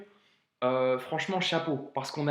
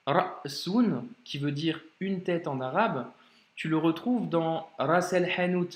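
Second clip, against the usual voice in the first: ordinary talk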